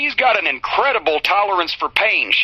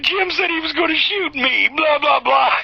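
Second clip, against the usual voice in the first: mocking whiny tattler; 8 semitones higher than usual. Mocking Brock tattling to Danny.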